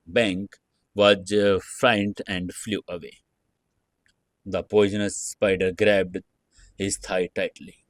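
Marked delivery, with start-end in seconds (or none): none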